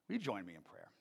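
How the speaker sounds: ordinary talk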